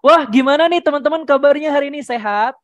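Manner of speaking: quick, loud, high-pitched audience greeting; energetic host, speeding along; fast, fired-up tempo. Shown as a demonstration. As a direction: pump up the crowd with a brisk hello. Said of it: "itu dipercepat temponya".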